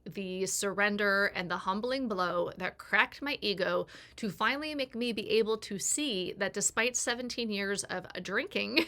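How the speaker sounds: normal talking voice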